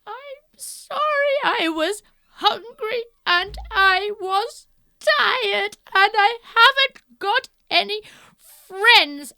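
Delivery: high, slow, pleading; high slow apologetic plea; sorry Goldilocks. Acted. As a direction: plead your sad excuse.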